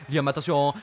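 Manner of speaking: ordinary talk, nothing marked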